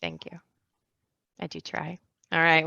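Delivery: ordinary talk, nothing marked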